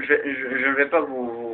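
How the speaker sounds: normal talking voice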